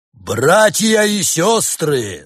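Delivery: high, loud, slow, straining cry; anguished cry. A narrator's reading. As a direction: call out with a break of anguish. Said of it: "с надрывом воскликнул Барс".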